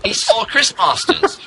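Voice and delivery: normal talking voice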